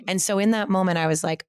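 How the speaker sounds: ordinary talk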